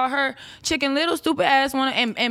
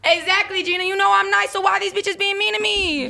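high, loud indignant protest; indignant appeal; 10 semitones higher, 3 dB louder than usual. Real, not acted. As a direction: plead your case, worked up.

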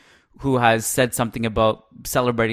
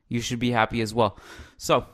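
ordinary talk, nothing marked

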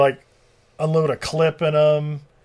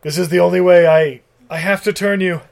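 louder, firm declaration; mock dramatic vow; 4 semitones higher, 8 dB louder than usual. Vampire to dying Tina, louder hammed vow. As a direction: say it with grave resolve. Vampire decides to turn her.

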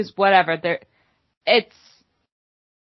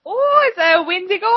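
very high comic Minnesotan cry; hammed-up Minnesotan amazement; bad Minnesotan accent; 12 semitones higher than usual. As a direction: exclaim in over-the-top Minnesotan. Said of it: "This is the worst Minnesotan accent".

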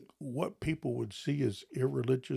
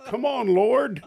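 exasperated plea; 10 semitones higher, 9 dB louder, slower than usual. Retold or imitated.

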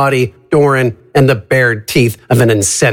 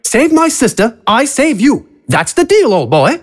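high theatrical villain, smug; a smug, high showman announcing; 10 semitones higher than usual. Acted. AJ bargains, character voice.

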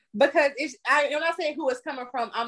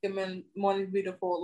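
mock husband; 4 semitones lower, 6 dB quieter than usual. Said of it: "and he was like".

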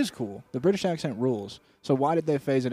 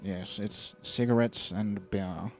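slow, softer mock British accent; 4 semitones lower, 6 dB quieter, much slower than usual. King savouring his new accent's smell, slow British accent. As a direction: draw it out in a put-on British voice. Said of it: "that new British accent smell".

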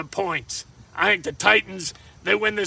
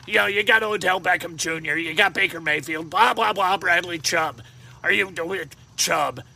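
ordinary talk, nothing marked

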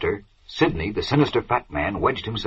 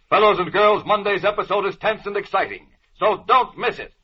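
rising, urgent cliffhanger narration; old radio announcer; 4 semitones higher than usual. Acted. Serial narrator's dramatic cliffhanger.

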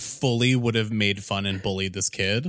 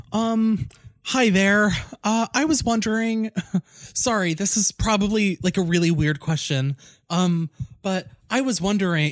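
put-on high, hesitant awkward kid; nervous kid voice; 7 semitones higher than usual. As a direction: stammer it out like a shy boy. Retold nervous kid asking a question.

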